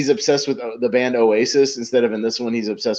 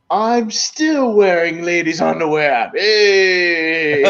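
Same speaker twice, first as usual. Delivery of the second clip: higher, slow goofy proclamation ending in a cheer; high drawn-out comic boast; silly sketch voice; 6 semitones higher, much slower than usual. Retold or imitated. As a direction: proudly announce it like a clown. Retelling Hammond's recurring line.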